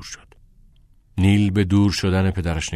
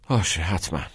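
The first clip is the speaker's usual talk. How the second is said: indifferent. Acted. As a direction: shrug off باشه، حتما without interest.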